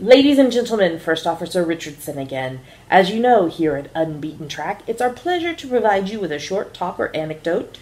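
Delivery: loud, grand cabin announcement to passengers; first officer announcing to the passengers, smooth and grand; airline announcer. Acted. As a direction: play the smooth first officer introducing a talk.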